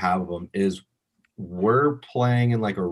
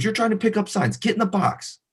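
mock coach yell; 12 semitones higher than usual. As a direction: imitate the coach snapping an order. Coach insists.

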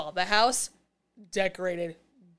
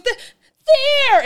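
hammed-up high, loud cry; very dramatic; 12 dB louder than usual. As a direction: drag it out into a melodramatic shriek. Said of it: "It's very dramatic".

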